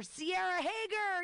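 big host announcement. Real, not acted. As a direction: call the name out to the room. Host announcing next comic.

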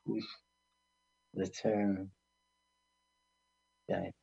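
usual speaking voice

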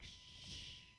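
hushed shush, then soft scold; librarian shushing whisper. Retold or imitated. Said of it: "And I'm like".

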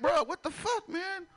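high-pitched mock bewilderment; exasperated, voice shooting up; baffled protest. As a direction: squeal it in comic disbelief. Acts baffled at many questions.

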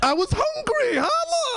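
high-pitched defensive cry; indignant protest. Acted. Comedian defending his food talk to the crowd, indignant high protest.

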